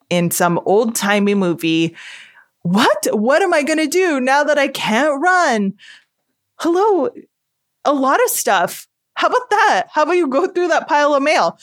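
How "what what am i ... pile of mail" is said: high, sarcastic, half laughing; mocking melodrama. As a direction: tease yourself, going high. Mocking her own melodrama.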